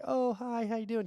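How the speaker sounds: high, sugary fake-nice hello; mock cheerful fake politeness; fake friendly greeting. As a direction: greet a boss you secretly dislike.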